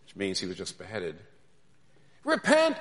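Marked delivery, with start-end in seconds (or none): 2.2-2.8 very high, loud cry; imitated cry, cut short by the axe; prophet's last shout. Replays cry before the beheading.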